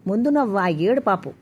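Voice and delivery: plain reading voice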